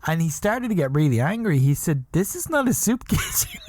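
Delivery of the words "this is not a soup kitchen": laughing quote. Real, not acted. Angry guy quoted, teller laughing.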